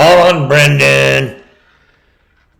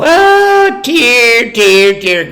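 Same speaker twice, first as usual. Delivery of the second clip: high falsetto mock sobbing; mock crying women; 9 semitones higher, much slower than usual. Mocking women viewers, high fake tearful whimper. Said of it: "So all the women go".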